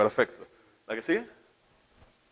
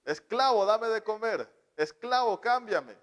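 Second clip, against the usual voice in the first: higher, demanding little tyrant ordering a slave; child commanding parent as slave, higher and bossy; bossy child master; 7 semitones higher than usual. Retold or imitated. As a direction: bark orders like a spoiled kid. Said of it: "Muy subjetivamente les están gritando".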